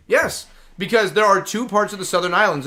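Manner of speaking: normal talking voice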